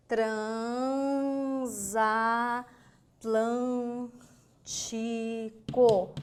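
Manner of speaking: "Transatlântico" very slowly as tran, za, tlân, ti, co; syllable by syllable. Shown as a demonstration. Teacher sounding out the word for students, very slow stretched syllables. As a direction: hold each syllable of "Transatlântico" long and level. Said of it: "Vamos imaginar a palavrinha?".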